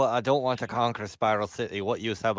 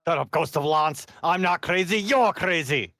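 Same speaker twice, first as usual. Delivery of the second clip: annoyed shout; 7 semitones higher, 3 dB louder than usual. Acted. Yelling at the ghost voice.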